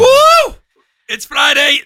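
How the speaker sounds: high, loud whoop; Friday cheer. Real, not acted. Host celebrating Friday on air, loud whoop. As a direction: whoop it up for the weekend.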